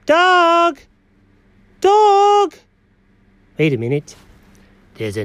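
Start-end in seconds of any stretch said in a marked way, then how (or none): 0.1-2.5 calling out. Acted. Calling out for the absent dog.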